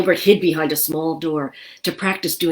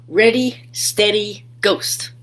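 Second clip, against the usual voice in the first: playful countdown; 4 semitones higher, 3 dB louder, slower than usual. Acted.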